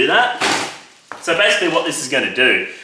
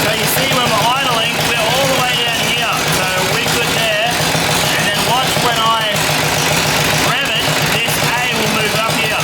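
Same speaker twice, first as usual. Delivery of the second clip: much higher, raised voice over engine noise; shouting over engine; 8 semitones higher than usual. Real, not acted. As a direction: call it out over the running engine. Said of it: "not sure if you're gonna be able to hear me talk over the engine".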